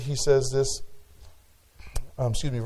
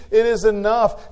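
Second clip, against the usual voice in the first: louder, higher "It is enough"; ringing declaration; 7 semitones higher, 7 dB louder than usual. Acted. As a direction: proclaim "It is enough" to the hall. Climactic list of grace.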